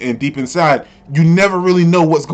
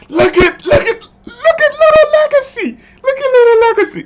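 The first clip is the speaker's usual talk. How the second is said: excited squeal; 3 dB louder than usual. Acted. Excited outburst over the character.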